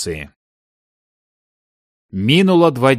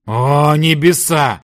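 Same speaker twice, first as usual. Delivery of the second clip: exasperated pleading; 3 semitones higher, 4 dB louder, slower than usual. A narrator's reading. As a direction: implore the heavens in despair at him. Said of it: "взмолился мужчина". (взмолившись, с отчаянием)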